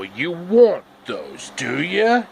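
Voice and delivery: sly wizard. Acted. Wizard voiced in story.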